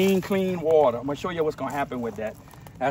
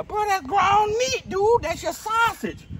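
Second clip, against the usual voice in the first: much higher, slower, louder burst of excitement; getting all excited; 4 dB louder, slower than usual. Real, not acted. Hunter gets worked up about wasted sausage meat, excited. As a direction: squeals with joy over the meat. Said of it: "I'm getting all excited".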